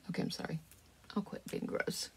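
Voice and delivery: sheepish whispered apology; whispering. Real, not acted. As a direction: whisper it, embarrassed. Apologizing sheepishly after gross moment.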